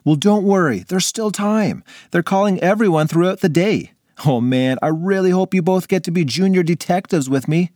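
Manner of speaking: lightly voiced cheerful kid; bright eager friend; upbeat boy voice. Ray's voice, upbeat.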